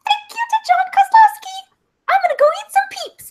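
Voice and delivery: high-pitched squeaky thank-you; cute bird voice. Pretend. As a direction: talk like a tiny cute bird. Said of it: "this weird high-pitched voice thingy".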